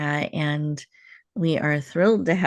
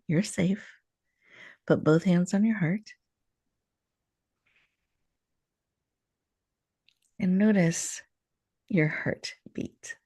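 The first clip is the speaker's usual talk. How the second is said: guided meditation. Acted. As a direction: ease the listener into stillness, gently. Leads a heart meditation.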